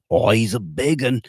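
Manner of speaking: lightly put-on Australian accent on a movie line; mock Australian movie hero. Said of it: "Was that Australian?".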